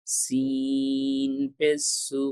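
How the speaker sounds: সিন পেশ সু drawn slow with a strong hissed s; sharp hissed seen. Shown as a demonstration. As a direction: stretch সিন পেশ সু slowly, hissing the s. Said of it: "সিনের উচ্চারণটা এই যে স". (জোরালো স-ধ্বনিতে ধীরে উচ্চারণ)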